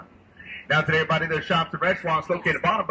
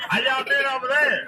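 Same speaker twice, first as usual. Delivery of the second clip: calling from far away; 9 semitones higher than usual. Real, not acted. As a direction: call out to strangers on the bank. Calling to people on shore.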